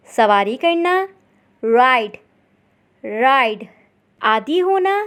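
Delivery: ordinary talk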